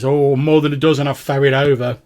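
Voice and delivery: plain-spoken ferryman. Acted. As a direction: answer loudly as the ferryman. Game master plays the ferryman.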